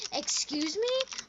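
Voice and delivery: slow, high, offended excuse me; indignant. Retold or imitated. Mom's friend confronting the kids, high indignant. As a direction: stretch it out, scandalized. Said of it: "And she goes".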